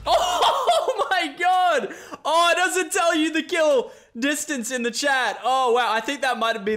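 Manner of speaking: very high, loud, drawn-out laughing squeal; can't believe the shot, laugh it out; thrilled disbelief. Real, not acted. Long-range snipe just landed.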